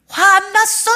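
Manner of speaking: high sharp mock denial. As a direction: squeak out a tense denial. 화 억누르는 사람 흉내. (화 안 난 척하는 사람의 높고 날카로운 흉내)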